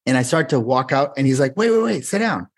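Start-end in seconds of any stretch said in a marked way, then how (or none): 1.6-2.5 eager call back. Retold or imitated. Producer stops him leaving.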